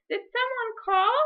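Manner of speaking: high, curious call into the streets; fox calling out. Acted. Fox in the market calling out to a faint cry, curious. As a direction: call out like a lost little fox. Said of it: "He heard a cry".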